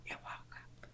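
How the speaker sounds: very soft whisper; hushed, barely audible whisper. Real, not acted. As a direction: say it under your breath in a whisper. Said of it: "Why you whisper?".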